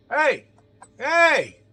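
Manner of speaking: loud, high guard yelling through door; hollering guard. Acted. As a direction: bellow it like a guard calling shift change. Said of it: "they're hollering at us".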